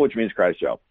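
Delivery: ordinary delivery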